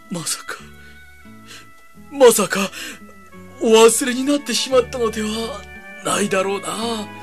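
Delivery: rising alarm, then trailing off; worried. Acted. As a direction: fret that he's been forgotten. Fears Jesus forgot him.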